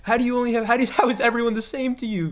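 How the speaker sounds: high, loud disbelieving questions; baffled, rising to a squeak; incredulous. Retold or imitated. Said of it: "in my head, I'm wondering".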